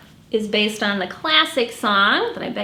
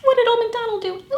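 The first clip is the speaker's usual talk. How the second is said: much higher, quick playful question; excited question; 12 semitones higher, much faster than usual. Acted. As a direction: quiz the kids with excitement. Teasing question to kids.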